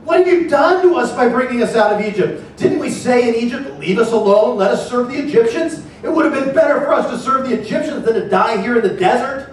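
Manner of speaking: high, loud desperate complaint to Moses; frightened Israelites cry out in accusation; panicked outcry. Acted. Israelites crying out to Moses, high panicked complaint. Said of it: "they cried out to the Lord".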